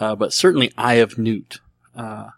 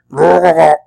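loud throaty Wookiee growl, pitched up; Chewbacca roar; 5 semitones higher, 10 dB louder than usual. Pretend. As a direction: gurgle it out like Chewbacca. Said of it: "Do your best Chewbacca impression".

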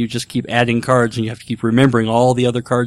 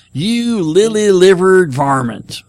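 slow, high hammy cowboy drawl; 7 semitones higher, 5 dB louder, slower than usual. Acted. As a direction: growl the insult like an old Western cowboy. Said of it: "If you're not using your horrible cowboy voice".

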